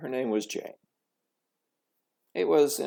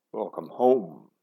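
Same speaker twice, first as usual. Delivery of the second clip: lightly voiced father; 5 semitones lower, 5 dB louder, slower than usual. A narrator's reading. Father greets daughter.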